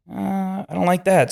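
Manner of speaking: high, wary mock alarm; uneasy surprise. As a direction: react like the leg just did something weird. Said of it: "and I'm like".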